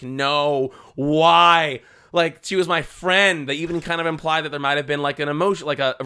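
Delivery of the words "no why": a touch of anguish. Retold or imitated.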